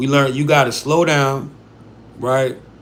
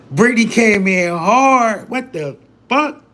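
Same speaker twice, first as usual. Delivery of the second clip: high, drawn-out astonished reaction; amused disbelief; 7 semitones higher, 4 dB louder, slower than usual. Real, not acted. Streamer reacting to Brittany's blunt opening, excited disbelief. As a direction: stretch the words out, pitch jumping up in disbelief.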